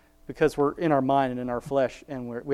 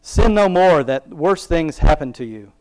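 louder, declaiming Bible reading; scripture reading; 3 semitones higher, 7 dB louder than usual. Acted. Reading the Bible verse aloud to her viewers, declaiming and louder. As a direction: proclaim the verse from the pulpit.

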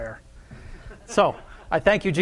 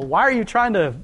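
ordinary delivery

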